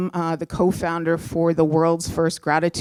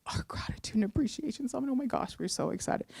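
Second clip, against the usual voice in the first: tearful whisper; 7 semitones higher, 9 dB quieter, faster than usual. Retold or imitated. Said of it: "And we start crying".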